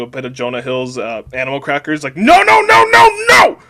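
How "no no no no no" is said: high-pitched full-force yelling; frantic screaming. A narrator's reading. DiCaprio waving his arms wildly, screaming. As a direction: scream out in a flailing fit. DiCaprio flailing, protesting in story.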